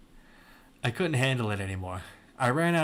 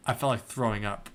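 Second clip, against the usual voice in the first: ordinary delivery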